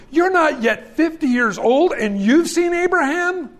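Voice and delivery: rising mock astonishment, fifty years old; incredulous.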